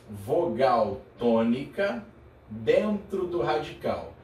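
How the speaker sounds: every word drawn out, spaced for note-taking; slow dictation. Shown as a demonstration. Teacher to student copying notes, slow careful dictation. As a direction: dictate it slowly so students can write.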